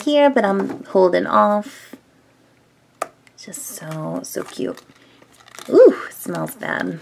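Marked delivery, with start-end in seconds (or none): 3.4-7.0 whispering. Real, not acted. Squishy review, whispering close.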